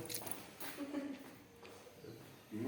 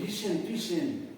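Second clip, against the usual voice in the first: Argentine accent; 3 semitones higher, slower than usual. Shown as a demonstration. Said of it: "con acento argentino".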